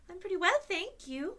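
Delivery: put-on bright, polite little girl; polite girl's voice; polite Dorothy. A narrator's reading. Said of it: "replied Dorothy politely".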